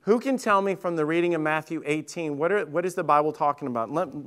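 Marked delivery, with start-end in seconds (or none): none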